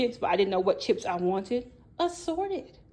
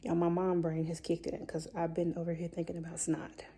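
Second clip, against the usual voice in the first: hushed unvoiced whisper; 2 semitones lower, 6 dB quieter than usual. Real, not acted. Mom whispering to viewers about her sneezing kid, hushed. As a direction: whisper it so the house stays quiet.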